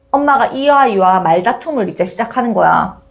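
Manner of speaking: ordinary delivery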